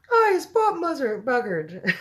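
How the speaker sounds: high, loud giant's screech; hammed-up screech in a put-on voice. A narrator's reading. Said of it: "screeched the butcher boy".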